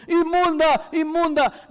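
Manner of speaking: a woman warning the crowd she is unclean; calling out unclean. Retold or imitated. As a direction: call out the warning like a leper. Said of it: "salir gritando".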